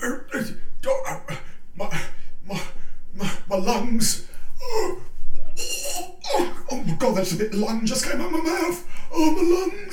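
high strained wheezing groans; hammed-up dying man. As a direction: overact coughing up a lung. Victim choking up lung.

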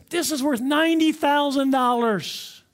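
high, drawn-out big reveal; acted thrill, stretching out the words; excited expert. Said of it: "he's so excited".

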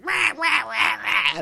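imitated crow cawing. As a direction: caw like a scolding crow. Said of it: "and he's just like".

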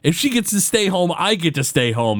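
mock the indignant complaint of a jealous brother; negative accusation. Bart protests his sister staying home, negative and indignant. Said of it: "Bart does it in a very negative way".

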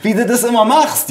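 high-pitched marvelling mother; delighted mother. A narrator's reading. Mother amazed by her son's technical skill, delighted. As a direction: gush with admiration, voice pitched high. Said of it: "rief meine Mutter begeistert".

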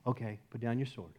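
soft, low, matter-of-fact answer; quiet instruction. Retold or imitated. God replying to a would-be believer, quiet instruction.

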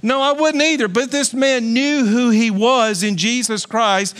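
ordinary delivery

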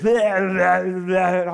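hammed-up possessed woman babbling at him. Retelling a possessed woman confronting him, slow loud gibberish. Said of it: "act like she's possessed with the devil".

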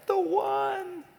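high, airy mock-longing voice, trading questions with himself; mock dreamy single. Preacher playing a lovesick single hunting for the one, high mock voice. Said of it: "the idea goes".

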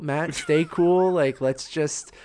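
slow, deliberate self-soothing; calming himself down. Retold or imitated. Retelling how he told himself to stay calm in the cinema, slowly. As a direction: talk yourself out of losing it, slowly.

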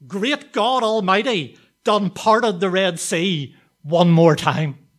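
loud, high and slow, full of joy; ecstatic shout. Retold or imitated. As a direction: shout it out like an old woman overcome with praise. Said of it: "she shouted".

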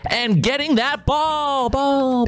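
high, mock sports-broadcast hype, last words stretched; peppy co-host announcer. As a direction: play the excited second commentator. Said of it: "I'm the really, like, peppy".

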